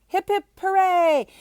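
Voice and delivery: high, loud cheer. Acted. Palace crowd cheering for Gooney Bird, high and loud.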